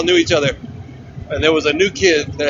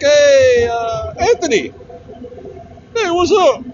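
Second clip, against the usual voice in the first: calling out Anthony; 9 semitones higher, slower than usual. Retold or imitated. Retelling how he hailed the new kid at the event, a high eager call. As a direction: call across the room to the new guy. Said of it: "I was like".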